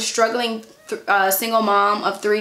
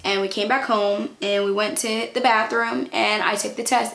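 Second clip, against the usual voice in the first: usual speaking voice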